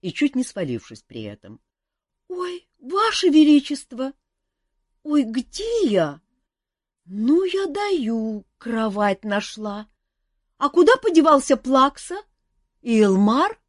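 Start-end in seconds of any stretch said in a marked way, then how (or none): 2.3-13.6 put-on high, loud flustered girl voice. A narrator's reading. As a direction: babble in sleepy confusion. Olga flustered on waking.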